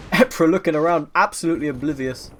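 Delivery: rising hype as the shot lines up; excited casting. Real, not acted. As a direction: call it loud and thrilled. Caster hyped on a close play.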